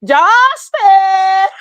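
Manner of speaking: imitating Amara's wailing call. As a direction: cry the name out in Amara's voice. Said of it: "in Amara's voice".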